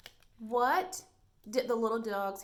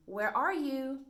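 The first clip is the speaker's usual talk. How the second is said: higher, louder calling question; 5 semitones higher, 6 dB louder, slower than usual. Shown as a demonstration. Teacher showing a memory trick, calling where are you. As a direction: call out as if searching. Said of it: "if you say".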